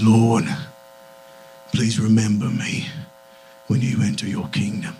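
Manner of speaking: low, hushed British plea; British-accented thief. Acted. Dying thief turning to Jesus, low British-accented plea. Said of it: "everyone had a British accent".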